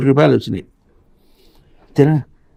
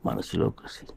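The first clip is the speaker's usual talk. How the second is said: whispered emphasis; 5 semitones lower, 17 dB quieter than usual. Real, not acted.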